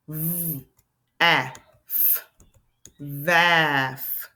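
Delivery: segmented sounding out, stretching each sound. Shown as a demonstration. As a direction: tap out v, ah, f slowly. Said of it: "Nonsense word".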